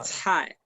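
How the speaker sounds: "chai" as ts with air expelled; "chai" said ts-hai, falling tone; aspirated C. Shown as a demonstration. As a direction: start "chai" like Z but with a puff. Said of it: "pero expulsando aire".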